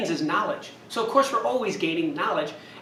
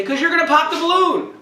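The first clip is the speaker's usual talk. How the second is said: mock campers answering, higher and louder; kids calling out; 9 semitones higher, 6 dB louder than usual. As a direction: play the whole group shouting the obvious answer. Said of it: "And they say".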